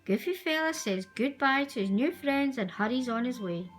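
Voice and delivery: usual speaking voice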